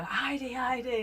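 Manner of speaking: high, sing-song hail across the water; calling out. Retold or imitated. Fellow boater hailing a friend passing by, high sing-song call. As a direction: call her name to get her attention.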